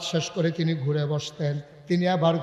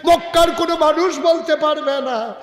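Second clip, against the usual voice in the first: high, loud wail of innocence; anguished protest; 12 semitones higher, 10 dB louder than usual. Acted. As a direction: cry out at the top of the voice. Abdur Rahman protests his innocence.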